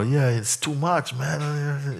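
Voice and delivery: drawn-out grumble to a friend; casual complaining. Retold or imitated. Teacher imitating someone chatting to a friend, slow casual moan. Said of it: "you know".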